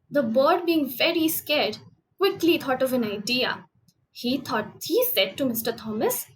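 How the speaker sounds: ordinary delivery, nothing marked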